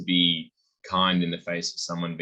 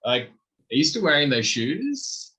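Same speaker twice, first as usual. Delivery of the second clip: high-pitched, drawn-out mock judgy jab; loud, high judgmental dig; judgy comment; 8 semitones higher, 5 dB louder, slower than usual. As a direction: play the snide critic, voice pitched up and slowed. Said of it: "someone hits you with a judgy comment going".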